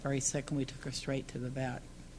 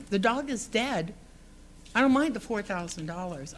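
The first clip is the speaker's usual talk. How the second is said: retold upset plea; 6 semitones higher, 4 dB louder than usual. Confronting the neighbor who fed wildlife, upset and raised. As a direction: make him hear the dog is gone. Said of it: "I just said".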